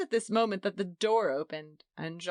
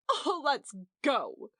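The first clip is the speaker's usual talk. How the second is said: crying out; 7 semitones higher, slower than usual. A narrator's reading. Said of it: "cried Edith".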